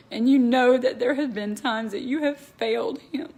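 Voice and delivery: ordinary talk, nothing marked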